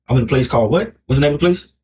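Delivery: ordinary talk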